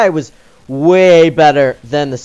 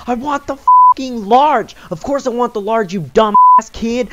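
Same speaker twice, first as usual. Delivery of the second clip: angry shout; 7 semitones higher, 4 dB louder than usual. Retold or imitated. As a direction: bark the order at the kid. Customer flipping out.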